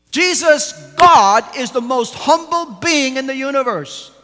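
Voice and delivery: fervent pulpit climax; rising preaching fervor. Acted. Preaching at full force.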